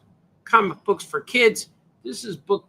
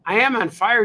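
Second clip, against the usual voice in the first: mock pained yelps; 5 semitones higher, 6 dB louder than usual. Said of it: "[name] just burnt her tongue".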